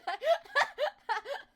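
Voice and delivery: loud high laugh; high burst of laughter. Real, not acted. Laughing at slogan.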